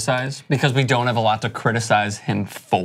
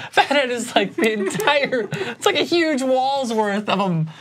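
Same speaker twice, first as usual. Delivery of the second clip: laughing; 11 semitones higher, slower than usual. Real, not acted. Laughing about the fat-head cutouts.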